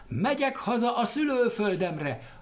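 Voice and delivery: high, joyful reply from above; happy answer. Acted. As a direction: call down gladly. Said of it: "a boldog válasz". (boldog, magas válasz fentről)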